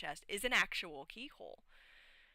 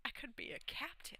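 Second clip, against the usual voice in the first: slyly whispered, pitched a bit higher; whispered scheme; 7 semitones higher, 9 dB quieter than usual. Retold or imitated. The schemer plotting to become captain, whispered. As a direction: hiss it as a secret plot. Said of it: "and I'm like".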